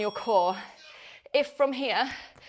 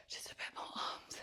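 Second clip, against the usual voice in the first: whispering; 16 dB quieter than usual. Real, not acted. Whispered mid-workout.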